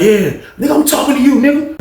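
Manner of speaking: high, pointed challenge; angry confrontation. Retold or imitated. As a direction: call him out, pitch climbing. Acts old head confronting man.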